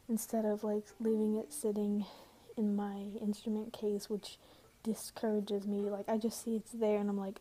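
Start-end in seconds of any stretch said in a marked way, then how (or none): none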